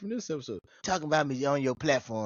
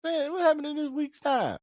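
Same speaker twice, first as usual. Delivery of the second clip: high mock protest; 13 semitones higher than usual. Son to his stubborn mama, high indignant protest. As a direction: squeak out a wronged protest.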